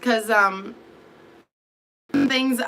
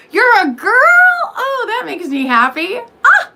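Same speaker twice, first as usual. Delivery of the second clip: high, loud, laughing delight; 13 semitones higher, 10 dB louder than usual. Real, not acted. Reacting to a viewer who turns out to be a girl, delighted and loud. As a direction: squeal it with real joy.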